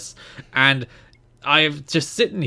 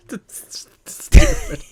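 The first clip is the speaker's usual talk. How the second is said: very high, slow squeal of disgust; high, drawn-out mock indignation; squeaky mock outrage; 7 dB louder, much slower than usual. Mock outrage after laughing.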